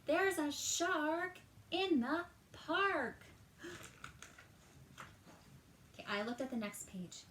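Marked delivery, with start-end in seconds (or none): 0.1-3.2 high, loud, slow alarm shout; excited yell. Acted. Timothy alerts the park, yelling with alarm. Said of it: "Timothy yells". 3.6-4.4 big breathy shocked gasp; dramatic gasp. Acted. Storyteller gasps in mock shock at shark.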